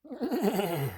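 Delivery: high wavering whinny; imitated high whinny, falling away; horse neigh. A narrator's reading. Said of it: "Says the horse".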